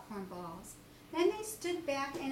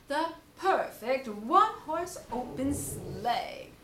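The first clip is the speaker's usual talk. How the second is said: exclaimed Owl; 5 dB louder than usual. Acted. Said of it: "exclaimed Owl".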